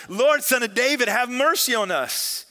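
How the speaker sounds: high, loud cry for mercy. A narrator's reading. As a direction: shout it insistently, pleading. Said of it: "shouted all the louder".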